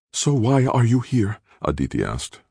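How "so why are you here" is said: high, tense question; brittle with tension. A narrator's reading. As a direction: ask it on edge, voice pitched up. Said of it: "her voice brittle with tension".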